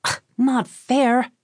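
high, drawn-out frustrated whine; frustrated outburst, only mildly shouted. A narrator's reading. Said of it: "he shouted in frustration".

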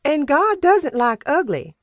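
high, prim and loud, with weight on doesn't; sniffy reproof. Acted. Housekeeper rebuking Sam at dinner, high and sniffy. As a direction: lay down the rule with a disapproving sniff. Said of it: "Peaches sniffed".

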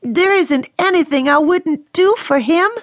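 high, earnest vow; mean every word; the daughter defending her love; devoted daughter. A narrator's reading.

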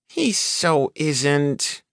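put-on high, slow child's tease; teasing child. A narrator's reading. Girl teases her father about Robbie. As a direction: drag it out like a cheeky kid.

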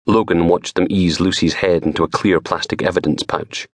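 ordinary delivery

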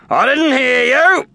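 high, loud slow challenge; demanding trainer. Acted. Maxie demands a reply from Snag, loud and stern. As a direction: press for an answer.

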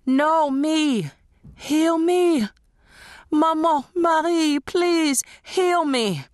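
high, loud, frantic begging to be healed; desperate pleading screams. A narrator's reading. Ghostly crowd begging Marie to heal them, screaming. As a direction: cry out as if grabbed by a crowd.

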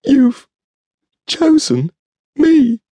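a shrill-free high, loud tearful burble of joy; burbling. A narrator's reading. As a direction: gush it out in overjoyed disbelief. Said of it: "I burbled".

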